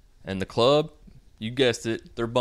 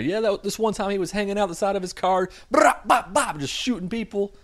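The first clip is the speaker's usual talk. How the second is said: high, excited hype-man voice with a louder gunshot burst; bragging homie; 12 semitones higher, 4 dB louder than usual. Retold or imitated. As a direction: tell it like a proud boast. Crew bragging about gangbanger past.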